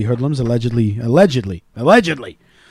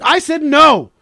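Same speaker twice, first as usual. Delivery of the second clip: high, loud, slowing into a stubborn shout; insisting to his wife, mock yell; 9 semitones higher, 6 dB louder, much slower than usual. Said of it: "I was like".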